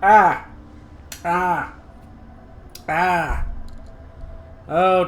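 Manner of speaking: pained groans, chest aching from laughing. Real, not acted. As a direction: wince through sore ribs. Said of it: "My chest".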